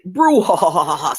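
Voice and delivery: slow mock villain cackle; evil villain laugh. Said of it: "sounding like an evil villain".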